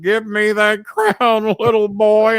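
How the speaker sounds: slow taunting heel voice; chasing taunt. Retold or imitated. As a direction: call after him while chasing. Funk chasing, demands crown.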